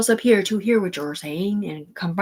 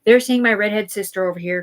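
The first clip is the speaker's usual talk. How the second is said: usual speaking voice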